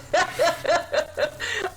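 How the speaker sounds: rising giggle. Real, not acted. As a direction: giggle at the banter. Laughing after teasing.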